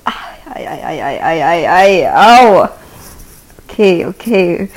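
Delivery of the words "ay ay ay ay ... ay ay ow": building cries of pain. Real, not acted. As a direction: cry out as the pain grows. Massage hurts, building cries.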